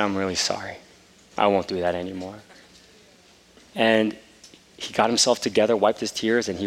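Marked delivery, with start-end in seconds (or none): 0.0-2.4 sincere apology. Retold or imitated. Father apologizing to son.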